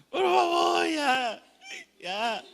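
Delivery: high, slow, desperate cry of please; panicked shout. Retold or imitated. Trapped man shrieking at people to let him out.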